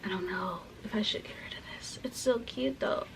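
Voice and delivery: soft, whispered indecision; musing to herself. Real, not acted. Debating to herself over keeping it, hushed whisper. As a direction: whisper it under your breath. Murmuring to herself while sorting clothes.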